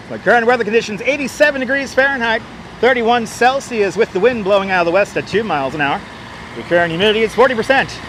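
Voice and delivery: radio announcer voice. Pretend. Mock weather report on stream.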